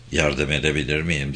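soft, low and gentle. A narrator's reading. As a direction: ask her softly, with care. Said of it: "diye sordu hafifçe". (yumuşak ve nazik)

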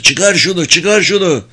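narrator's contained cry of panic; urgent shout. A narrator's reading. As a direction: beg urgently, pitched well up. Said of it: "diye bağırdı".

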